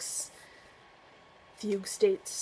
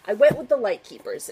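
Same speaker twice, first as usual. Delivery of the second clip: usual speaking voice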